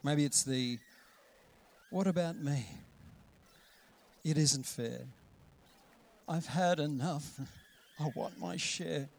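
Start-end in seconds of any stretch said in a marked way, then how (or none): 1.9-9.1 understated self-pitying sulk; mild poor-me voice, trailing softer. Acted. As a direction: lightly play feeling hard done by, slowing down. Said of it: "Self-pity voice".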